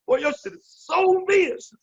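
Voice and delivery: "so vicious" blown up very high; hammed-up imitation, hitting "so vicious" hard and high; exaggerated emphasis. Retelling Mario's compliment amid laughter, "so vicious" with exaggerated high emphasis. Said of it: "He put emphasis on that shit like".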